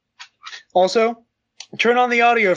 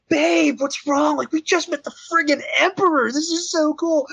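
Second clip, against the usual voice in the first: excited; 9 semitones higher than usual. Retold or imitated. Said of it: "And I'm like".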